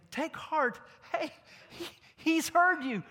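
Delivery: high, excited call; excited news. Acted. Crowd excitedly tells the blind man Jesus heard. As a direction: rush to tell him the good news.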